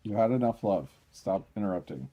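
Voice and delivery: loud, playful scolding of a pet. Real, not acted. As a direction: tell the pushy cat off, half joking.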